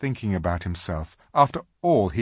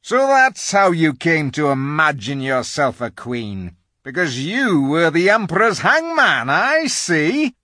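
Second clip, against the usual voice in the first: high, scornful beaver voice; 8 semitones higher, 8 dB louder than usual. Acted. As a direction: jeer at the witch. Mr Beaver mocks the witch.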